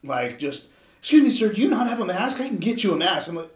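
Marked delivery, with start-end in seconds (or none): 1.0-3.3 higher, louder indignant store-worker imitation; mock someone mad, confronting a customer; pitched-up, put-on officious challenge; mad mask enforcer. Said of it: "they'll be so mad".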